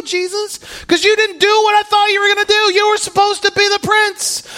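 high, loud, furious shout; angry yell at Jesus. Retold or imitated. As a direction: yell at God for letting you down. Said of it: "Peter's like".

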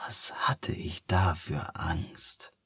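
soft breathless whisper, slow. A narrator's reading. The old sea bear recalls her fearless cliff dive, whispering. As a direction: breathe it out in wonder. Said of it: "flüsterte die Seebärin".

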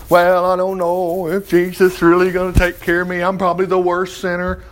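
put-on monotone of a doubter; mock your own worried second thoughts; self-doubting sinner. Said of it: "all of a sudden I'm thinking".

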